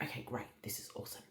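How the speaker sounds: very soft imitation of a pleased midwife; hushed midwife. Midwife hearing labour has started, very soft imitation. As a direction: murmur it almost under the breath.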